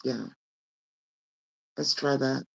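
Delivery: ordinary delivery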